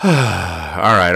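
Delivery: normal talking voice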